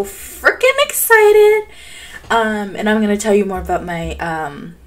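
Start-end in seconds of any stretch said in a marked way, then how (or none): 0.0-1.7 high, slow, loud gushing; bursting with excitement; squeaky excitement. Real, not acted. Gamer waiting for the trailer, high and stretched out.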